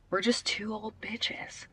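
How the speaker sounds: soft, slow unvoiced whisper; whispered aside to the dog. Real, not acted.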